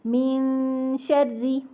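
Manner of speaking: slow melodic tajweed chant of कुल अऊज़ु बिरब्बिल फलक; Quran recitation. Shown as a demonstration.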